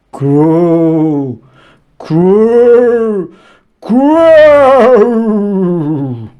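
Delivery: spooky growl. A narrator's reading. Narrator voicing the scary forest growl, menacing. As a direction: rumble it like a hidden beast. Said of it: "The growling got louder".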